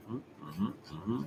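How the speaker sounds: ordinary talk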